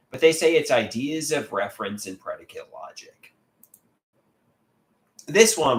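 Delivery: usual speaking voice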